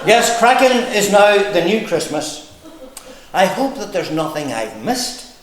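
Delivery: measured, rhythmic verse reading; comic poem recital. Acted. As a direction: recite it in lilting lines with pauses. Reads own poem to audience.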